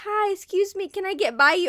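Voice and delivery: high, sweet and overly polite; mock polite request. To shoppers blocking the aisle, a high overly polite request. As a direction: ask to squeeze past as nicely as possible. Mimicking asking to pass politely.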